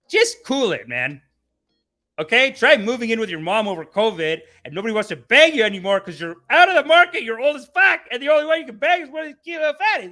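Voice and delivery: high, loud scolding that climbs; heated rant. Pretend. Host scolding Jordan Peterson over body remarks, heated rant.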